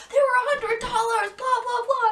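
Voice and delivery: high, loud mock angry dad; mock yelling dad. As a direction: rant about the sunglasses. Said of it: "my dad was yelling at me".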